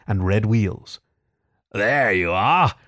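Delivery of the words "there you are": drawn-out, showy cry; triumphant cry. A narrator's reading. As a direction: present it proudly with slow, swooping emphasis. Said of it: "cried the Toad".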